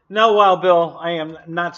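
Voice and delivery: loud. Real, not acted. Answering viewer's chat comment.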